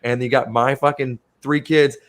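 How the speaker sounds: ordinary talk, nothing marked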